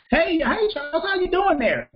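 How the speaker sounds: high-pitched country old-lady greeting, imitated; sweet old lady's country accent. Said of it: "She has a country accent".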